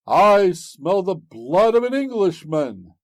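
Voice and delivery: loud, high booming giant chant; ogre's cry. A narrator's reading. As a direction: roar it out like a hungry giant. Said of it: "cried out the ogre".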